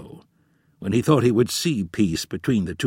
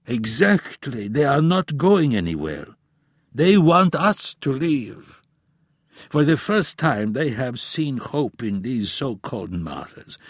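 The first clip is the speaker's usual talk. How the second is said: put-on grave old professor; 5 semitones higher than usual. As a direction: warn like a weary elder.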